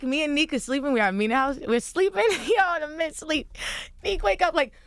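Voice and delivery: ordinary talk, nothing marked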